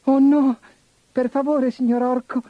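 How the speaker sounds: put-on high squeaky begging kid goat; pleading little goat. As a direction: beg in a tiny high voice. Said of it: "implorò il capretto".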